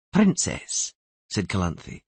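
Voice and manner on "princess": high-pitched, cold royal command; coldly authoritative, with an imperious edge. A narrator's reading. Queen summoning her granddaughter before the Earl, cold and authoritative. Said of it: "in a cold and authoritative voice".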